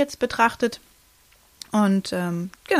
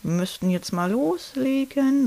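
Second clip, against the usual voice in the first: midwife's cue; 5 semitones higher, slower than usual. Retold or imitated. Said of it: "sagte die Hebamme".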